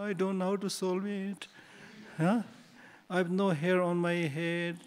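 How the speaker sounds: put-on high, slow, pleading baby voice; crying newborn. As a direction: plead like a helpless newborn begging for help. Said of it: "The child is saying".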